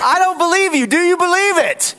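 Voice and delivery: high, forceful pulpit cry; impassioned challenge. Real, not acted. Preacher presses the church to believe, impassioned challenge. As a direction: press the congregation even harder.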